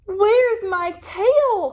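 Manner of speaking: high, loud and slow distressed cry. Acted. Lizard cries out for his lost tail, high and loud. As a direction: wail it out in alarm. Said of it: "cried little lizard".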